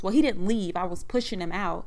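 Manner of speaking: usual speaking voice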